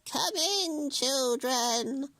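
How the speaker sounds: put-on high, sugary old woman; sweet witch voice. A narrator's reading. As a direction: lure them in sweetly. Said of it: "she said, smiling".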